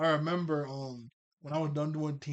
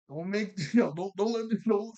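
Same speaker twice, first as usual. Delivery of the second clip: a loud, high, laughing half-quote; Valerie voiced laughing; 5 semitones higher, 5 dB louder than usual. Vlogger retelling Valerie's line, laughing loudly through it. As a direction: stammer it while cracking up. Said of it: "She goes".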